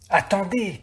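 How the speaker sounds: put-on a called-out attendez from the old shepherd; calling out. A narrator's reading. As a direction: stop them before they pull. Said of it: "crie-t-il".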